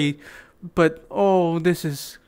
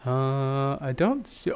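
normal talking voice

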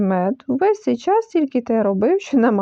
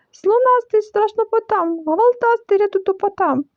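shouting; 8 semitones higher than usual. A narrator's reading. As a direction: keep wailing in panic, pitched up. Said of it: "Так він кричав". (панічний крик)

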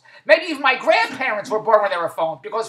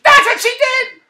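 high, loud disbelief; 12 semitones higher, 7 dB louder, slower than usual. Real, not acted. Still laughing at the story.

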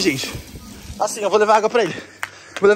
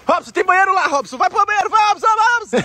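urgent shouting; 9 semitones higher, 5 dB louder than usual. Real, not acted. Urging friend to run to bathroom.

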